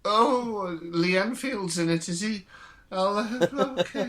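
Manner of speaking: put-on high, bewildered old lady; a doddering old woman mishearing. June confused.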